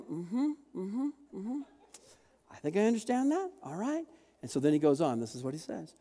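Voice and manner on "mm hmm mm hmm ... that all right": unsure disciples. Retold or imitated. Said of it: "imagine the guys going".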